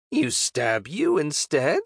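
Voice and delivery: slow, high goblin voice; goblin suggestion. A narrator's reading. Goblin makes a sly offer to the knight, slow creature voice. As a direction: offer it slowly, lifting into a question. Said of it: "the goblin suggested".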